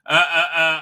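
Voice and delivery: ordinary talk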